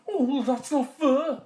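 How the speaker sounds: high, loud protesting dog voice; drawn-out, indignant dog complaint; talking dog. A narrator's reading. Dog protests at unfair treatment, high and loud. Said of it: "said the dog".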